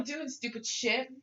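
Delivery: ordinary delivery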